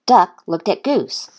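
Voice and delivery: ordinary delivery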